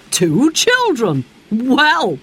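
slow, surprised man's voice; a fireman taken aback. A narrator's reading. Fireman finding children in his cab, surprised.

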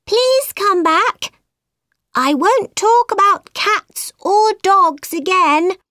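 high, pleading call to come back. A narrator's reading. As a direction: plead after someone swimming away. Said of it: "called Alice".

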